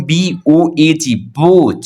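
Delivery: बी ओ एच ई बोट said letter by letter slowly; slow spelled-out demo. Shown as a demonstration. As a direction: spell it out for the class. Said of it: "समझ लगे that". (अक्षर-अक्षर धीमे बोलकर)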